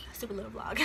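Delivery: breathy self-mocking whisper under the breath; whispered aside. Real, not acted. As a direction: drop to a whisper, poking fun at yourself. Self-deprecating aside about own vlog.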